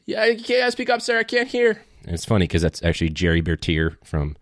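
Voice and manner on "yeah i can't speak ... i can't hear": high, strained half-deaf voice; like a bomb just went off by your ear; deafened soldier. Retold or imitated. Soldier deafened by a blast asks to speak up, high and strained. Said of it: "he goes".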